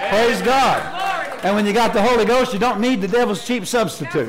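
loud, high preacher's cry; full-force preaching. Real, not acted. Preacher shouting to congregation about the Holy Ghost. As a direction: proclaim it to the church.

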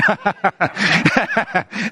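hearty real run of laughter. Real, not acted. Preacher teasing a quiet congregation, amused laughter. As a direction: laugh at your own joke.

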